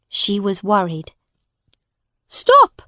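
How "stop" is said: very high, loud cry; shout. Acted. Woman to the judge, shouting. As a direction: cut the judge off. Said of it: "she shouted to the judge".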